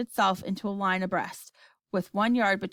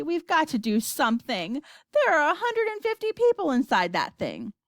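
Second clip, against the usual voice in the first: urgent plea climbing high; insist, pitch rising as it goes; crying out; 6 semitones higher than usual. A narrator's reading. Boy pleading for the trapped people, crying out. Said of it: "cried Charlie".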